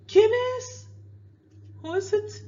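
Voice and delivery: shouting through the door; answering a knock. Shown as a demonstration. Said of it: "you say".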